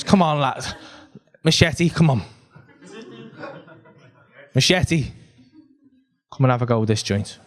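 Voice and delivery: low, soothing hypnotist's coaxing; calming. Retold or imitated. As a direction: talk down a furious man, unhurried and low. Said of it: "Machete just calmed down straight away".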